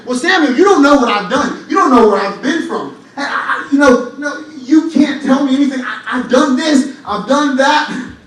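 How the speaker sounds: loud, pleading protest, slowing at the end; a congregant's loud self-justifying protest. Retold or imitated. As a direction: voice a listener insisting on their past, loud. Congregant protesting their past.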